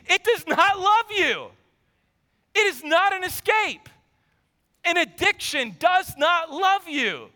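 normal talking voice

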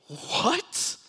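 high, questioning "what?"; retold freaked-out disbelief. Preacher recalls seeing something never seen before, high-pitched disbelief. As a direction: blurt it with a big upward leap. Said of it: "I was freaked out".

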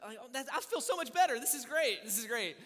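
high, loud burst of relief; relieved and excited. Retold or imitated. Husband relieved his wife only wants baptism, excited and loud. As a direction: let the relief pour out, bright and loud.

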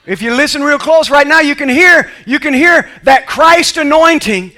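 high, loud fiery preaching; preaching at full force. Acted. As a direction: build it to a shout.

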